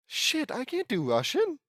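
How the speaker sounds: high-pitched squeal of dismay; high, dismayed outburst; real dismay. Real, not acted. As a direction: blurt it out high in panic. Reader dismayed at accent required.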